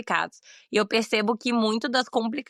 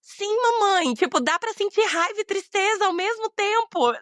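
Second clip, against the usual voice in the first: put-on much higher, louder small-boy voice; the son realizing it's possible, high and bright; child's voice; 10 semitones higher, 4 dB louder than usual. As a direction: play the surprised little son. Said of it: "assim, de".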